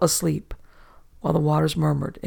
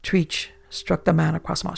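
plain reading voice

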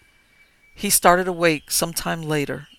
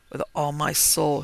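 plain reading voice

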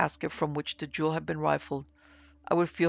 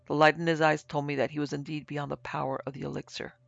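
plain reading voice

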